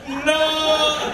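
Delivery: high long-held cry; high drawn-out shout; frozen no. Retold or imitated. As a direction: hold the no like a paused frame. Bystanders frozen mid-shout.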